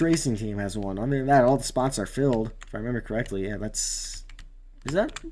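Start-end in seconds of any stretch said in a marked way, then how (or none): none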